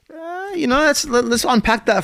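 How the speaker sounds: high pitched. Real, not acted.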